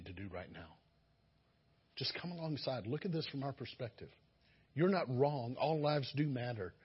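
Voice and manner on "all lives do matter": usual speaking voice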